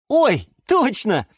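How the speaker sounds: high, drawn-out "oh, right"; suddenly remember; sudden realisation. Acted. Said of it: "спохватился Юра". (спохватившись)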